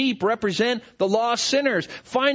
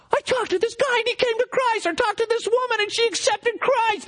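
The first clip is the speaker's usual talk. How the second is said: much higher, bubbling over with news; excited telling; 13 semitones higher than usual. Acted. Believer telling everybody they know, excited. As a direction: gush the good news to a friend.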